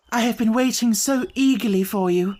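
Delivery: a lightly voiced young lady, eager and relieved; eager cry. Miss Stoner greeting Holmes at last, eagerly. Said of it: "she cried".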